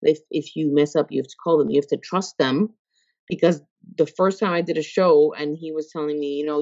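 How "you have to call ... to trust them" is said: normal talking voice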